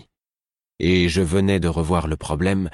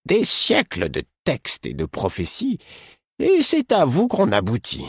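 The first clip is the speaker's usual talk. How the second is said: annoyed; 12 semitones higher than usual. Acted. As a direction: let irritation leak through, high. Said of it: "une fugitive expression d'agacement".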